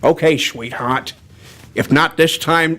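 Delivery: low, slurred film tough guy; Humphrey Bogart. Acted. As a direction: slur the s like an old movie star. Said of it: "a little bit of Humphrey Bogart".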